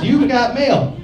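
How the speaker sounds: high-pitched singsong computer announcement; computer mail alert. Acted. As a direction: mimic a chirpy laptop email greeting. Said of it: "gets out his laptop and hears".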